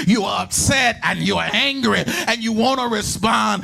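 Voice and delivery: rolling, half-sung sermon climax; full-force preaching. Real, not acted. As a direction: preach it in a relentless, chanting build.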